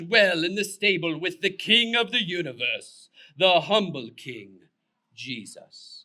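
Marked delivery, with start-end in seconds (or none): none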